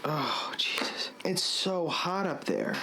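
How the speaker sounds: breathless slow whispering; drained from the heat, whispered; exhausted whisper. Real, not acted. Exhausted after hot roof.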